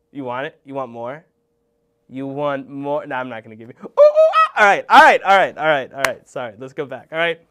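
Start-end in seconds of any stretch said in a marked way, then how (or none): 3.8-4.5 very high, loud monkey shrieks; monkey hoots. Pretend. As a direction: hoot like a wild spider monkey. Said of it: "that was too much".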